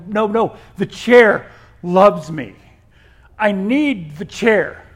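hammed-up protest, slow and emphatic after the first burst; mock-desperate insistence. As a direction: plead for it loudly, drawing the words out. Acts out insisting on the chair.

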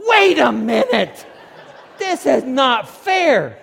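loud, slow, high mock shout; comic outraged yell, drawn out; indignant protest. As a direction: holler a hammed-up complaint. Acts his indignant protest to God.

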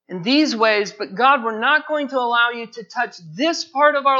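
loud, rising pulpit fervour; impassioned revival sermon; preaching at full force. Real, not acted. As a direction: preach it hard, pleading with the congregation.

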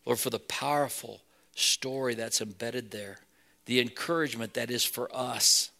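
hushed, earnest closing prayer; steady pastoral prayer voice. Acted. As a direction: pray it sincerely over the room.

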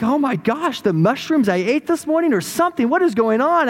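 high-pitched comic disbelief; bewildered. Acted. Balaam hearing his donkey talk, bewildered. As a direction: babble in mock astonishment.

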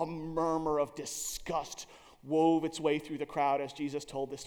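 ordinary talk, nothing marked

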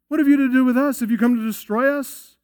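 a cornered demon crying out in dread; terrified demon. Retold or imitated. Preacher acting out the terrified demon before Jesus. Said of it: "This demon is terrified here".

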